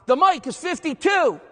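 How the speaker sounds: high, loud imitated line call; yelling the call. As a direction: bark out the defensive call. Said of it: "if you hear him yell out".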